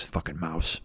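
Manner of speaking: low, very quiet grumble; muttered aside. Real, not acted. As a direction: grumble it under your breath. Muttered aside grumbling about cartoon mouse.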